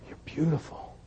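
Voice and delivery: hushed, tender reassurance; tender God. Pretend. God speaking tenderly to child.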